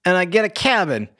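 high, incredulous recap. Retold or imitated. Son questioning his dead mom's will, high and incredulous.

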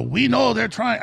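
put-on half-hearted Indian accent; attempted Indian accent. Shop owner tells Alex they support him, light Indian accent. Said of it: "I can't really do an Indian accent".

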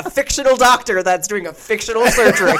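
laughing through the words; can barely hold back laughter. Retold or imitated. Laughing while quoting herself.

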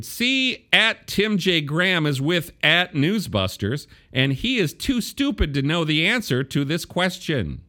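high-pitched read-out of an insult; mock proclamation. Host reading Olbermann's insult about himself, mock proclamation.

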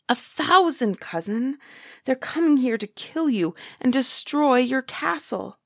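high, alarmed storybook delivery; urgent warning. A narrator's reading. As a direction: warn him of mortal danger. Jack alarms the giant with warning.

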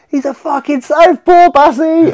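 high, loud, slow bellow from the corner; retold corner yell. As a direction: yell the warning across the cage as the bell goes. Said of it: "Sean, goes".

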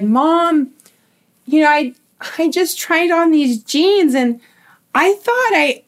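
high-pitched, distressed mock plea; imitating upset teen. Girl confides in her mom from the fitting room, imitated distress. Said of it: "just sobbing, just crying".

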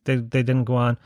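ordinary talk, nothing marked

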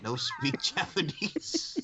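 slow, high quote broken by laughter; drawn-out comic line with a laugh. Retold or imitated. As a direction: crack up midway through the quote. Quoting a funny line, cracking up.

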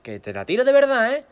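high, loud retold threat; shouted warning. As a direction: warn him loudly that you mean it. Final warning to brother.

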